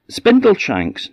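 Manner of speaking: very high, drawn-out offering; silly-name guess. A narrator's reading. Queen tries ridiculous names on the little man, tentative. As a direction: toss it up as a daft question.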